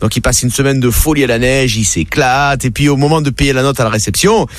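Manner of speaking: ordinary talk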